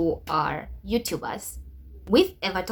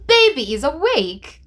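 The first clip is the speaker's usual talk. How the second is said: much louder, higher joyful cry; excited burst; 6 semitones higher, 11 dB louder than usual. Real, not acted. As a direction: announce the miracle with a thrilled shout. Laptop turns on, miracle.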